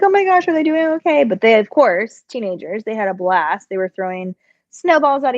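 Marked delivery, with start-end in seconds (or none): 0.0-1.2 high, fast worried gasp; anxious mom checking on kids. Retold or imitated. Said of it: "I was like".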